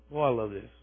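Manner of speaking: normal talking voice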